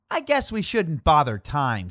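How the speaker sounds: usual speaking voice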